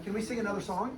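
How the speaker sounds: plain reading voice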